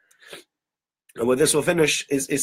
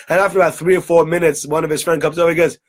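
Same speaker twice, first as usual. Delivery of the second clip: normal talking voice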